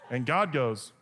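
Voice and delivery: normal talking voice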